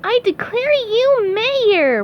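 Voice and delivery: high, slow, loud proclamation; SpongeBob pronouncing. Acted. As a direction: declare it grandly in cartoon pitch. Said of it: "pronounced SpongeBob".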